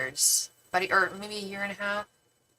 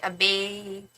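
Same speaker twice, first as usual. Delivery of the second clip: put-on Chicago accent. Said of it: "they'd be like".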